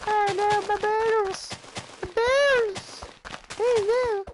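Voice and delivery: very high, loud squeal of pain; mock squealing Eli. Cousin kicked in the groin, squealing high. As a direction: shriek like a kicked kid. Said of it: "Eli's like".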